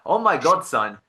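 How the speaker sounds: dismayed mock outburst, high and loud, then dropping; unhappy dad. Dad hearing son has dropped Deloitte, dismayed. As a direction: imitate a dad appalled at his son. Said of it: "my dad was not, not happy".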